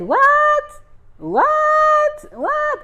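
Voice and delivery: high, loud shocked "what", repeated; incredulous, high-pitched outburst. Retold or imitated. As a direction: squeal each "what" in disbelief. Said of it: "I was shocked".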